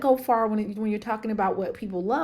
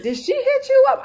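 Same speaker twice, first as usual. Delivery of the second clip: high, loud excited quote; eager question; 6 dB louder than usual. Retold or imitated. Girlfriend asking if reader got in touch, eager and high. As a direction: imitate a friend dying to know, pitched way up.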